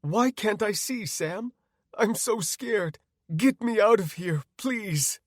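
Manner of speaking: high, pleading, frightened woman; high-pitched panicked plea; growing panic. Acted. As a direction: beg to be rescued. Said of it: "she said with growing panic".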